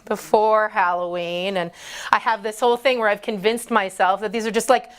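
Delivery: ordinary talk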